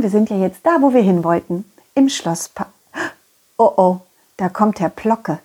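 usual speaking voice

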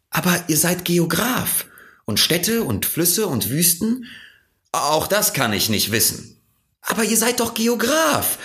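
put-on high child's voice, insisting in disbelief; a boy who can't believe the grown-up; Little Prince, puzzled. A narrator's reading. (hohe, ungläubig drängende Kinderstimme)

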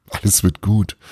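ordinary delivery, nothing marked

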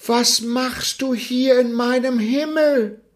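hohe, empörte Sonnenstimme; empörte Sonne. Acted. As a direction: frag hoch und entrüstet, wie eine glühende Sonne. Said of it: "sah ihn mit ihren glühenden Augen an". (die Sonne stellt den Jungen hoch und entrüstet zur Rede)

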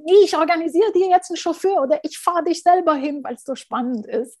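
retold insistence. Host insisting on driving him.

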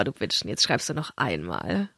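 low smug mutter; gloating low mutter. Retold or imitated. Retold gloating thought.

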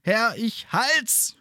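high, faint, fading reply; dying voice. Acted. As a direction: answer with the last of his strength. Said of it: "mit ersterbender Stimme".

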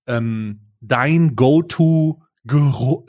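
ordinary talk

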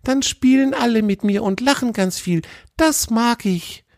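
put-on a dreamy little fox girl musing; high childlike voice. A narrator's reading. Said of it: "sagte Grina, das kleine Fuchsmädchen".

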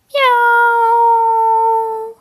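high, loud drawn-out meow; cat meow. A narrator's reading. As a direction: meow like a cat, stretched long. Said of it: "macht die gefleckte Katze".

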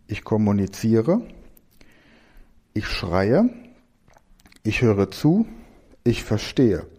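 each verb set apart, slow and clear; slow dictation. Acted. Teacher slowly dictating verbs to class for mindmap.

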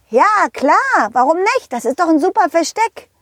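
put-on high, perky child voice; cocky little pirate. Little pirate answers in the story.

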